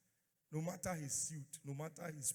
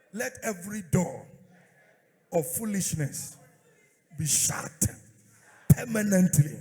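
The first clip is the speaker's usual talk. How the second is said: fiery prayer; 2 semitones higher, 13 dB louder than usual. Acted. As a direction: pray it with full force. Leads a loud prayer.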